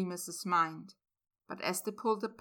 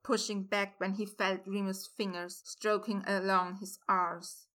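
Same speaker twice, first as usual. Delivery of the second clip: ordinary delivery, nothing marked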